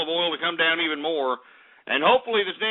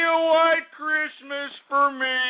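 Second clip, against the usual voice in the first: higher, slower, gleeful gloat; 9 semitones higher, slower than usual. Real, not acted. Host gloating to viewers about his own snow. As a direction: savor it, voice up high. Gloating over Texas blizzard.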